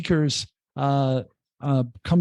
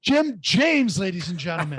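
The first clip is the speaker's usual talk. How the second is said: mock announcer; 3 semitones higher than usual. Guest playfully presents the podcast host to listeners, mock announcer. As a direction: introduce the host like a stage act.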